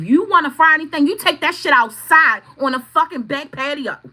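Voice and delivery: stern order. Retold or imitated. Ordering the mother-in-law to fry outside, loud and stern. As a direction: warn her off firmly. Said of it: "I said".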